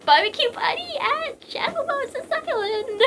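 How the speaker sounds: very high, drawn-out silly voice; silly party squeal. Pretend. Joking about barbecue party on the island, high squeal. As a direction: squeal it slowly like a mock invitation.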